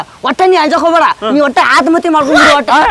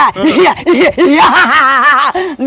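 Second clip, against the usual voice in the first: a hammed-up schemer's cackle; villain laugh. After "criminal idea" plan.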